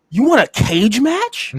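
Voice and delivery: high, slow incredulous question; mock disbelief. Rescued wrestler questions his rescuer's idea, mock disbelief. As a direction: draw it out as if you can't believe the offer. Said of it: "I'm like".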